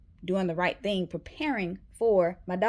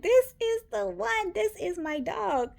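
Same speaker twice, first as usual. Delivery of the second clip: high, slow, gushing; high slow gushing over the perfect puppy; gushing delight; 13 semitones higher than usual. Retold or imitated. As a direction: swoon over the puppy, drawn out. Falling for the puppy.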